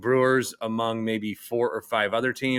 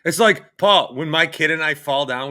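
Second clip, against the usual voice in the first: normal talking voice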